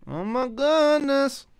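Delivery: high, slow, rising dismay; exaggerated exclamation. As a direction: stretch it and climb way up high. Exaggerated repeat, climbing high.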